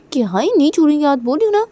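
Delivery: high, loud, quick protest; high excited protest. Acted. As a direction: squeal it out, tugging back. Playful tug of hands.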